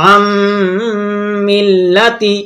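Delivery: "আম" ends in a long nasal hum before "মিল্লাতি"; nasal hold. Shown as a demonstration. Teacher showing the nasal rule, m of "আম" held long. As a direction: hum the m of "আম" long through the nose. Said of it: "আমাদের নাকে আওয়াজ নিয়ে পড়তে হয়".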